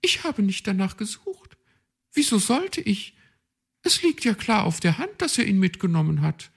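high, quick and smug; boast, pleased with herself; proud. A narrator's reading. Magpie brushes off the question, proud. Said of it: "nicht ohne Stolz".

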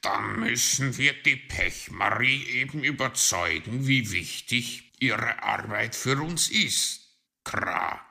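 loud crow character voice ending in a caw; put-on crow voice, forceful; crow with a plan. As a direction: argue loudly as a crow.

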